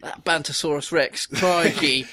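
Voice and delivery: hammy Australian crikey voice; Australian accent, played for laughs. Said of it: "was that your Australian accent".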